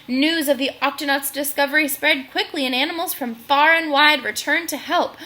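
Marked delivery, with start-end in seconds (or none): none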